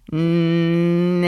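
long drawn-out bleating no; sheepish bleat. A narrator's reading. Shamed sheep fails the sum, long bleated answer. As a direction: hold the sound like a sheep.

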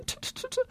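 rapid quiet tongue clicks; quick string of tsk sounds; disapproving tutting. Acted. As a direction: tut softly in disapproval. Aunt tutting over feathers.

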